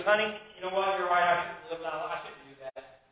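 very soft, sinking almost to nothing; hushed mock confession. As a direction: play a cheating husband owning up quietly. Acts cheating husband confessing to wife.

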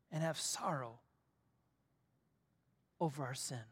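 soft pulpit voice with long, weighty pauses; hushed, tender preaching. Real, not acted. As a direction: bring the promise down to a gentle hush. Hushed close of the sermon passage.

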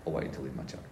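name called, then a quiet instruction; Jesus speaking. Retold or imitated. Jesus in a vision calls the speaker, low and quiet. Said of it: "Jesus going".

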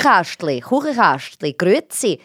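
sing-song Swiss German words. Shown as a demonstration. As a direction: demonstrate the lilting Swiss German sound. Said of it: "they sing more than the Germans".

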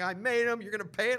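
high, loud, angry vendor; retold screaming. Sushi seller screaming at a customer refusing to pay, retold shouting. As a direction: shout it like a furious shopkeeper. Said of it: "He's screaming".

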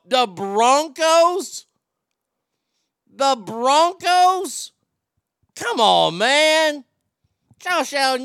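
exasperated, stretched-out words with loud swoops upward; exasperated rant. Real, not acted. Sports fan scolding the Bills for losing, exasperated. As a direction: let each disbelieving outburst swell and hang.

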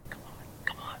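very soft retold whisper; urgent whisper. Teller holding the door for hiding mom, urging whisper. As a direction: whisper it to hurry someone inside.

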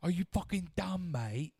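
high mock Gordon Ramsay rant; exasperated British chef voice; British accent. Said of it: "He's like".

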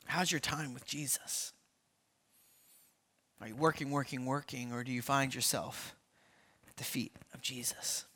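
hushed intimate question. Real, not acted. Pastor gently asking the congregation, hushed and intimate.

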